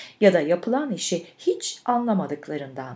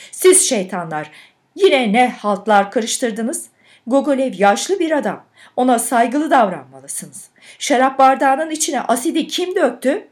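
loud, raised voice to frighten them; scolding shout; 4 semitones higher, 6 dB louder than usual. A narrator's reading. Patroness trying to frighten two mischievous boys, scolding shout. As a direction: shout an indignant scolding at the culprits. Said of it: "korkutmaya çalışır ve bağırırdı".

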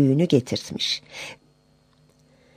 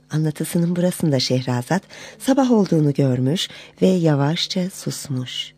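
plain reading voice

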